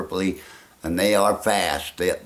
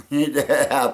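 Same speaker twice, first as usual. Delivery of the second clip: normal talking voice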